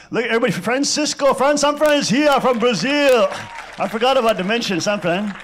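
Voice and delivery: loud, high, thrilled call to the room; excited announcement. Real, not acted. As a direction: announce the guest with delight. Excitedly announcing guest from Brazil.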